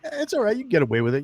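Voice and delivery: high, breezy buddy voice; friend's voice. Retold or imitated. Friend shrugs it off, high breezy voice. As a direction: shrug it off as the pal.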